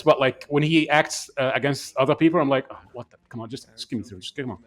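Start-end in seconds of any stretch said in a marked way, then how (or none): 2.7-4.6 much softer exasperated grumble; half under the breath, willing it to end; muttered impatience. Retold or imitated. Impatient with the dull scenes.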